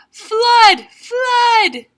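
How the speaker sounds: very loud, high alarm cry. Acted. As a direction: raise the alarm at full voice. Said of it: "and he cries out".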